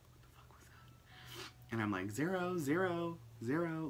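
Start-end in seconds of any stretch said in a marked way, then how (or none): 0.1-1.7 hushed, slow frightened whisper; startled whisper. Retold or imitated. Startled by a loud bang. 2.1-3.9 higher, louder calling out a name; calling the dog. Retold or imitated. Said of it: "like, calling his name".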